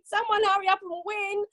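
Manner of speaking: normal talking voice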